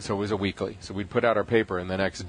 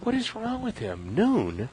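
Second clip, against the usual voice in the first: mock outrage; 10 semitones higher than usual. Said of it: "We were like".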